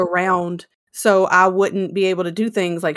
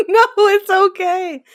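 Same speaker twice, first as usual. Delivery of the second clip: high laughing reassurance; laughing through it; 12 semitones higher, 3 dB louder than usual. Real, not acted. Laughing off mother's death.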